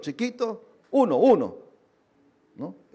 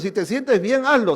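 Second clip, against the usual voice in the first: tempting voice, loud; 4 semitones higher, 7 dB louder than usual. Retold or imitated. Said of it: "ese pensamiento ... de qué espíritu viene".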